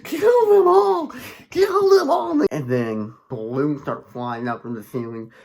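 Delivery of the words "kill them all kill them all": high, loud screeching chant; shrieked, drawn-out clown chant; Pennywise. Retold or imitated. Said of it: "Pennywise is like".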